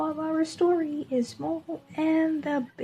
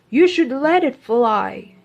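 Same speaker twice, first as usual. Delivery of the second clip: louder dad laying down the point; a hint of a stern father; firm father; 11 dB louder than usual. Dad tells Mo to free bird.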